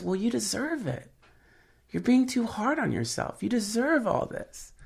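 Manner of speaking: mock phone lady, high and soft. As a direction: voice a gentle woman on the line. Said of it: "And she goes".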